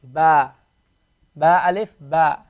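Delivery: ba with a long drawn-out aa; ba stretched long and steady; elongated vowel. Shown as a demonstration. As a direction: hold the aa in ba for two beats. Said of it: "gives you the ba sound".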